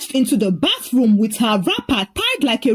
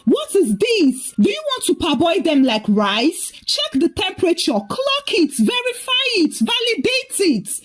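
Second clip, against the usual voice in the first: alarmed shout; 7 semitones higher than usual. A narrator's reading. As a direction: climb higher with each demand. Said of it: "saw the hot water and shouted".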